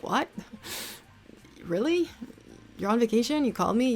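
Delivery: high, incredulous questions; disbelieving, with a laugh; surprised. Retold or imitated. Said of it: "I was like".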